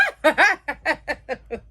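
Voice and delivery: bursting out laughing high; big real laugh. Real, not acted. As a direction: laugh out loud at the joke. Laughing at the joke.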